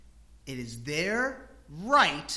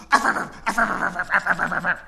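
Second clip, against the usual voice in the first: loud mock dog barks, laughing through them; dog barking; 10 dB louder than usual. Pastor imitating his dog barking at the kids, loud mock barks. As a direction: bark like the yappy family dog. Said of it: "the dog goes".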